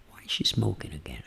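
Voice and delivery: low whisper to oneself; intimate voice. Shown as a demonstration. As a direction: murmur it privately, very low. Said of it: "Falando comigo mesmo". (sussurrando baixinho para si mesmo)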